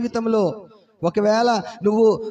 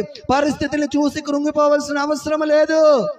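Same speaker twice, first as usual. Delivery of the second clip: high, raised preaching cadence; fiery preaching; 5 semitones higher than usual. Acted. Pastor exhorting the congregation, raised high preaching voice. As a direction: drive it home to the congregation.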